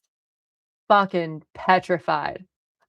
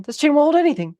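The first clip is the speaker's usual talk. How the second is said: panicked outburst; 8 semitones higher, 5 dB louder, faster than usual. Retold or imitated. Retold panic about the chain.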